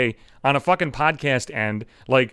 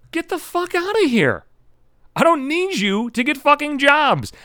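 high-pitched, incredulous outburst; indignant, high-voiced brush-off; indignant retort; 10 semitones higher than usual. Real, not acted. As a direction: push the pitch way up in exasperation. Indignant retort to dad's message.

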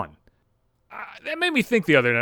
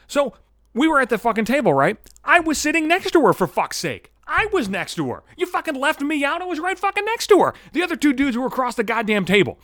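worked up; 6 semitones higher than usual. Real, not acted. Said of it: "I'm all fucking worked up here".

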